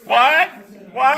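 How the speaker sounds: ordinary delivery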